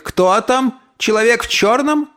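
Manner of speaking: high piercing question; shrill call. Acted. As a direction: call through the door shrilly. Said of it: "пронзительный отклик".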